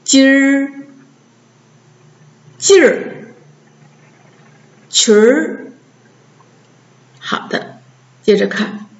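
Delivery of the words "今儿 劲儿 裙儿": 今儿, 劲儿, 裙儿 each one r-coloured syllable; teacher modelling 今儿, 劲儿, 裙儿 singly with pauses; erhua demo. Shown as a demonstration. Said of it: "跟着老师读".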